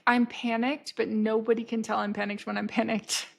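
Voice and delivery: ordinary talk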